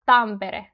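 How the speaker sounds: "Tampere" with a brief tapped r; weaker R. Shown as a demonstration. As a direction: flick the r lightly in "Tampere". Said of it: "R in Tampere sounds weaker".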